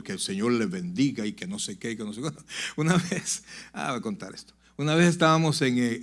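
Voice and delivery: ordinary talk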